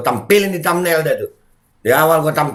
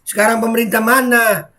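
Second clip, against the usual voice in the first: usual speaking voice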